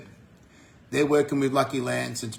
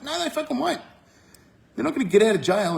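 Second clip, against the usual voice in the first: scoffing denial; 11 semitones higher than usual. Real, not acted. Scoffing at a fear.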